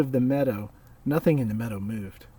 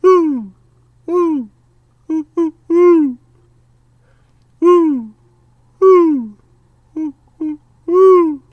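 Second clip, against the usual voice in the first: high loud owl whoo; hooting owl call in the night; 14 dB louder, much slower than usual. Acted. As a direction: call like an owl across the clearing. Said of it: "And then Pa called".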